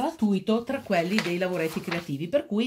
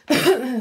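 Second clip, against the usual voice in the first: chesty cough; 6 semitones higher, 8 dB louder than usual. Real, not acted. Coughing while colouring.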